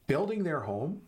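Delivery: ordinary talk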